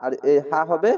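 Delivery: ordinary delivery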